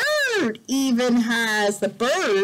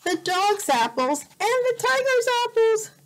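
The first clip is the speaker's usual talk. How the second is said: lively storybook voice, rising higher; reading to a child; 5 semitones higher than usual. Acted. Parent reads a picture book to a child, lively. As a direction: read it out to a little one.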